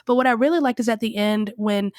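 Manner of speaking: ordinary talk, nothing marked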